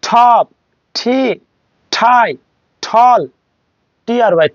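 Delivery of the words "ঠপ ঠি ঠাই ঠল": টপ, ঠি, ঠাই, ঠল, each opening on a puff; aspirated T. Shown as a demonstration. Teacher drilling T words, aspirated টপ, ঠি, ঠাই, ঠল. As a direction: separate words, every T like ঠ. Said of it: "ঠর উচ্চারণ হবে".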